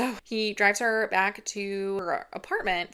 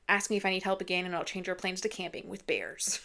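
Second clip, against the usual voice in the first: normal talking voice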